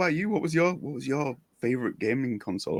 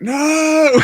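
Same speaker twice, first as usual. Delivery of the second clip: long, high, loud wail of defeat; mock despairing cry; 10 dB louder than usual. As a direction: howl it in hammed-up anguish. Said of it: "I'm gonna be like".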